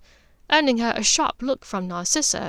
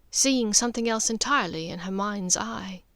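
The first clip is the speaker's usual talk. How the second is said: usual speaking voice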